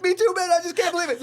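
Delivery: echoing the crying star, hammed-up emotion; mock tearful reply. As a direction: join in the tears, overwhelmed. Narrator replies emotionally in retelling.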